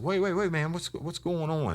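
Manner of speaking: high-pitched mock panicked protest; stammering, squeaky-high acted bewilderment; alarmed suspect. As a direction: imitate a rattled suspect, voice shot way up.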